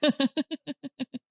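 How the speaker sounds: real high giggling laugh. Real, not acted. Host reacting to the killers' murder mystery weekend, laughing. Reacts to absurd event detail.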